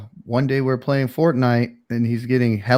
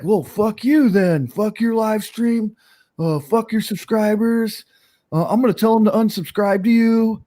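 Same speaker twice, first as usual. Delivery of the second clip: firing back, imitated; 8 semitones higher than usual. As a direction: imitate an angry friend lashing out, pitched up. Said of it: "he just fired back at me".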